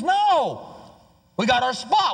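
high mock protest from the crowd; hammed-up crowd member guarding a place; indignant refusal. Crowd refusing to make room.

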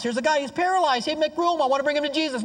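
high-pitched calling to a crowd; urgent helper. Acted. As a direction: act out pushing through with a sick friend. Friend pushing through a crowd.

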